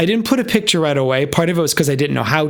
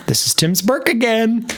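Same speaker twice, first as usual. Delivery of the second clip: dry retort; 7 semitones higher than usual. Retold or imitated. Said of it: "I was like".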